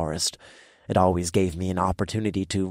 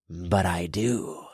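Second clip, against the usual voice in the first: slow, soft gurgling demon; calm menace, smoke in the throat; 4 dB quieter, slower than usual. Acted. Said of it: "My demon voice gurgled with smoke".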